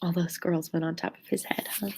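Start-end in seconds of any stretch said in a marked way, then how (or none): none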